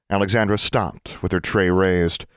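plain reading voice

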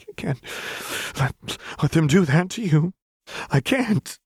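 high, breaking, panicky plea; panicked plea. Acted. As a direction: stammer it out near tears. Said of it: "Lux rasped".

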